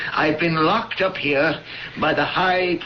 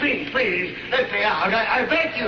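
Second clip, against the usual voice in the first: desperate begging; 5 semitones higher than usual. Acted. Trapped man begs to be released.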